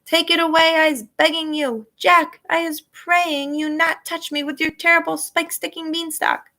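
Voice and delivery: high, slowed desperate pleading; begging giant. A narrator's reading. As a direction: beg for mercy from Jack.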